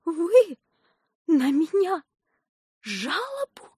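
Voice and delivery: high, halting incredulous question; stunned disbelief. A narrator's reading. Nurse faints almost at the doctor's threat, stunned disbelief. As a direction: pause between phrases, fading in shock. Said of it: "так удивилась, что чуть в обморок не упала".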